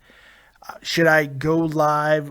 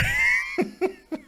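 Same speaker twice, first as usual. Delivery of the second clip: high shrieking laugh; 3 dB quieter than usual. Real, not acted. Laughing at own spoiler.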